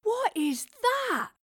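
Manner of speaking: high, slow, awestruck question; loud, high-pitched gasp of wonder. Acted. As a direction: stretch it out in amazement. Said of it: "Thomas gasped in wonder".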